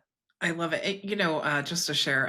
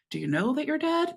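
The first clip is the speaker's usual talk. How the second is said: higher-pitched, quicker incredulous question; retold disbelief; 9 semitones higher, faster than usual. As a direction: act out baffled disbelief in a dream. Retelling her astonished dream reaction.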